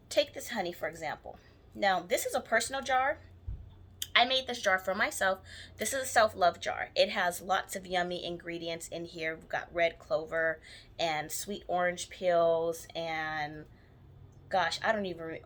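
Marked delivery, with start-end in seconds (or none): none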